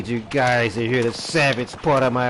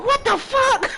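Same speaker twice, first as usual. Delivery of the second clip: high-pitched mock shriek of the woken wife; startled wife. Wife woken by blasting radio, high mock shriek. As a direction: shriek it like a woman jolted from sleep. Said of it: "She's like".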